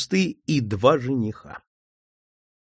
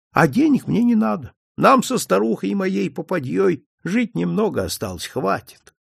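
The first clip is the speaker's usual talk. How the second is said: a kindly old priest, lightly voiced, higher; an old village priest telling his story, a touch higher; 6 semitones higher than usual. Old priest confiding to the detective, lightly higher.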